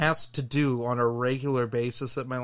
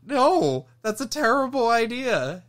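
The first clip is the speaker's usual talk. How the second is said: high, loud disbelief; incredulous; 9 semitones higher, 5 dB louder than usual. Real, not acted. Telling friend the coworker's plan, high incredulous. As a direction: scoff at the idea, pitch up. Incredulous retort while laughing.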